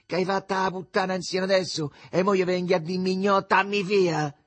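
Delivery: high, loud accusation; shouting outside. A narrator's reading. Mother on the landing accuses a neighbour, shouting. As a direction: keep hollering the charge. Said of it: "si mise a gridare verso fuori".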